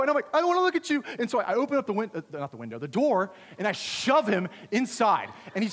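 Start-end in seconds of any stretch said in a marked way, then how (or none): 0.3-1.0 high, loud protest; indignant denial. Retold or imitated. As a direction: act out yelling back in exasperation. Leader recoils from naked kid.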